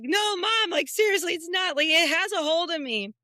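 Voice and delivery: high, loud frantic plea to mom; retelling a panicked child pleading. Retold or imitated. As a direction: beg mom as if something has you. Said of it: "And I was like".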